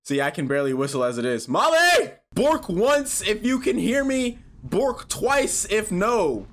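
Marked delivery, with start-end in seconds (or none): 1.5-2.1 loud, high call. Acted. Calling for the lost dog Molly in the dark, loud. 2.3-6.5 slow for the dog. Pretend. Asking the dog to answer.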